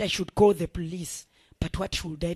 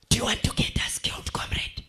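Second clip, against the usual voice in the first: hissed angry whisper; furious whisper under the breath; whispered fury. Acted. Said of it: "he whispered angrily".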